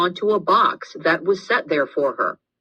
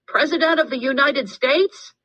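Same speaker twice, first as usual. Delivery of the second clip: much higher mocking yell; yelling through hands; 10 semitones higher than usual. A narrator's reading. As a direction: shout it through cupped hands to tease. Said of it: "Carlos yelled through the funnel of his hands".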